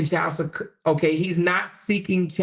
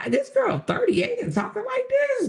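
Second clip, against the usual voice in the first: very high, outraged disbelief; shocked squeal. Real, not acted. Reacting to the comment's language, high shocked squeal.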